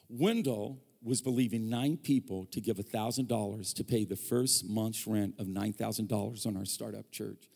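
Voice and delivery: ordinary delivery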